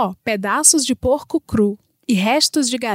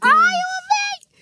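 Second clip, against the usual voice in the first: mock delight. Reacts to gross story ironically.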